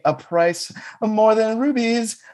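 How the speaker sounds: hammed-up showoff. Showing off prized syrup.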